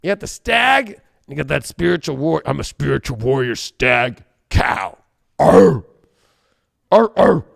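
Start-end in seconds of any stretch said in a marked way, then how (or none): 5.4-7.5 loud growling arr sounds like a juiced-up otter; otter noises. Pretend. To the viewers, mocking a steroid-pumped otter with loud barking growls. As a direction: bark out otter calls, louder. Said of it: "Now I'm sounding like a fucking otter that's been jacked up on steroids".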